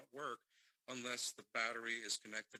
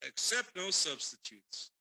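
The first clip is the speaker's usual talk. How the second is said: hammed-up ad announcer; 8 semitones higher, 8 dB louder than usual. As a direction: sell the lightsaber like a TV ad. Mock ad about Mace Windu.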